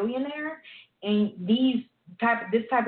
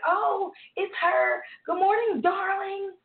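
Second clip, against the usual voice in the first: much higher, slow, wailing mock "Good morning, darling"; mocking high-pitched greeting; 11 semitones higher, much slower than usual. Coworkers mocking the diva coworker, high wailing greeting. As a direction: overdo it like coworkers teasing her. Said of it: "they started saying it".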